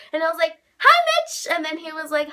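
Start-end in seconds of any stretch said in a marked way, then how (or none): 0.8-1.5 very high, loud retold squeak; panicked greeting. Awkward fan meeting Mitch, panicked greeting. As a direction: blurt it out high in mock panic. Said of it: "I kind of panicked".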